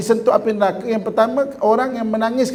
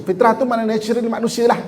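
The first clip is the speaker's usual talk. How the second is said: ordinary talk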